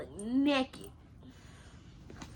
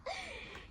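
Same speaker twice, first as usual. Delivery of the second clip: high giggle; 12 dB quieter than usual. Real, not acted. Laughs at camera.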